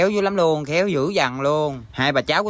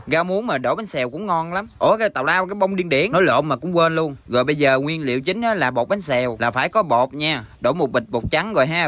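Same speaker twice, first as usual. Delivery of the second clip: normal talking voice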